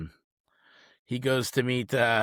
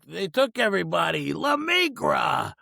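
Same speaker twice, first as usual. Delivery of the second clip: drawn-out, higher shout of La Migra; alarmed shout; 5 semitones higher, slower than usual. Retold or imitated. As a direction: yell the warning, stretching it. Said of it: "He's like".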